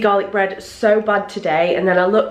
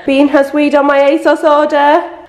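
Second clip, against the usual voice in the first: exasperated; 5 semitones higher, 8 dB louder than usual. Real, not acted. Exasperated about dog weeing.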